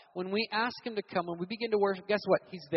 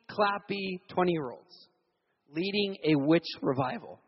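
ordinary talk, nothing marked

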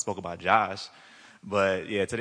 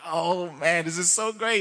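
high, louder re-enacted delight; elated; 9 semitones higher, 6 dB louder, slower than usual. Telling his friend how it feels, elated. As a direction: gush it, pitched well up. Acting out his joy at tingling.

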